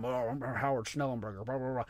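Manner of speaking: a hint of a drawling Southern coach, mocking; mock Southern impression. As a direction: tease an old Southern gentleman, kids' parody. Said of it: "they would do impressions of Howard Schnellenberger".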